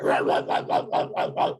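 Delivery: loud breathy barks of eldritch dogs; hounds barking. Acted. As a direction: bark it out like monster hounds. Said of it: "they start barking at you".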